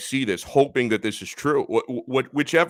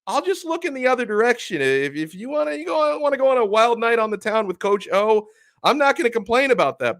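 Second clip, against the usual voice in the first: a much higher, breezy put-on voice of a resigned fan; mock fan voice; 10 semitones higher, 3 dB louder than usual. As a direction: play a desperate fan who shrugs it all off. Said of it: "you might even be willing to say".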